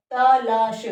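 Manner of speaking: तलाश said loud and sharp as the wrong spelling; stressed wrong form. Shown as a demonstration. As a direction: punch तलाश loudly as the mistake. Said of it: "तलाश शब्द नहीं होता".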